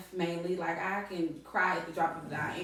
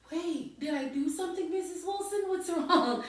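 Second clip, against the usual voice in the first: put-on higher, worried young student asking the teacher; a worried student, voiced higher; 10 semitones higher than usual. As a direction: play the boy, high and concerned. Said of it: "he was like".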